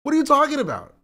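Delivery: loud, high disbelieving cry; incredulous outburst. Real, not acted. Streamer reacting to chat in loud disbelief. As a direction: shout it in total disbelief.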